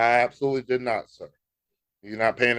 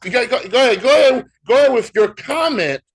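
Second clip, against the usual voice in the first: exasperated; 8 semitones higher, 8 dB louder, faster than usual. Real, not acted. Exasperated prompting co-host.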